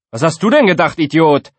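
high, loud schoolkid jeer; loud, indignant put-down; scornful bickering. A narrator's reading. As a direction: throw the insult at a classmate. Pupils bickering loudly in class.

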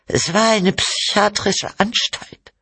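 very high, accusing correction; bitter. A narrator's reading. Wife exposes the psychiatric clinic, bitter. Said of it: "Cynthia lachte verbittert".

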